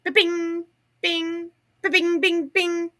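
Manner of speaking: high ba-bing notification sounds; double ping imitation. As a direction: chirp out the double pings. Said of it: "it would be like".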